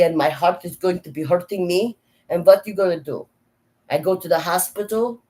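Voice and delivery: put-on fretting Slavic-accented grandma, pitch lifted; grandmother's thick Slavic accent. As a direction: scold a child with worry in broken, Slavic-accented English. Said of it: "I'm now going to become my grandmother".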